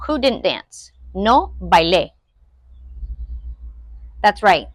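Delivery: ordinary talk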